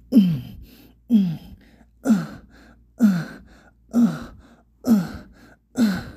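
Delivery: loud, high, sharply falling moans; forceful grunting moans. Acted. Narrator acting out forceful exertion, loud moans. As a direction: grunt with each hard effort.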